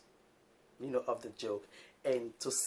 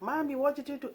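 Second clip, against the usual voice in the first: put-on much higher little boy asking; child's voice; 13 semitones higher than usual. Vlogger mimicking her son asking about the noise. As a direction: play the curious son. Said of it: "my sons heard it. I was like".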